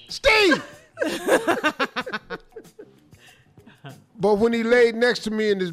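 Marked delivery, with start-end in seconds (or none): 0.1-0.6 high-pitched calling out of a name; comic holler. Pretend. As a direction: holler up at him in a squeezed high voice. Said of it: "I'm hollering at myself".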